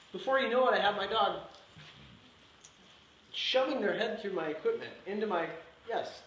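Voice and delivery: ordinary talk, nothing marked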